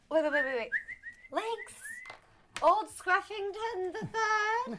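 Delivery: high, slow calling voice; man doing a woman's voice. Pretend. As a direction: call the lost dog in a high, sing-song woman's voice.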